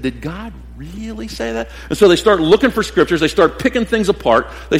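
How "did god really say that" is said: soft, sly doubting question; serpent's whisper. Retold or imitated. Satan whispering doubt to church leaders, softly. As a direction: plant the doubt in a hush. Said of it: "somebody has whispered".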